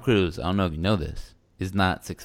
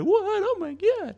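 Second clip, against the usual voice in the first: high shocked squeal; shocked gasp; slower than usual. Retold or imitated. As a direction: squeal it out in disbelief.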